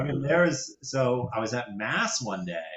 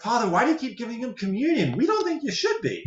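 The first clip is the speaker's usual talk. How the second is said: mock prissy churchgoer; 10 semitones higher than usual. Parishioners' complaint retold higher.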